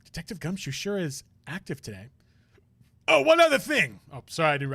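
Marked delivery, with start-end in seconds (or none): 3.1-4.0 suddenly loud, much higher call; booming call. Acted. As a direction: boom it out over everyone. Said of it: "blow your eardrums out".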